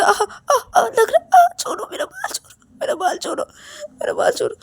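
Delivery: very high whimpering yelps; pained crying. Acted. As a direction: beg to be let go, crying in pain. Ravi's hair is being pulled. (दर्द से कराहते हुए ऊँची गिड़गिड़ाहट)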